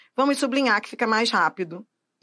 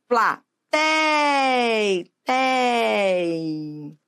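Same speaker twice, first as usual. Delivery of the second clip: "Platei" with TEI held very long; long, sustained "tei"; exaggerated stressed syllable; much slower than usual. Shown as a demonstration. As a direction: stretch out "tei" like a call. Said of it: "mas tem que pronunciar".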